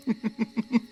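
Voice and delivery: a bright, high-pitched giggle. Acted. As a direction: giggle with delight at the joke.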